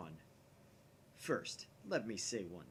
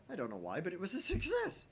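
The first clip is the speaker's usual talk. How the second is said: ordinary delivery, nothing marked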